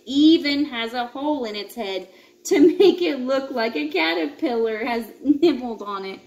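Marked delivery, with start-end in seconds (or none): none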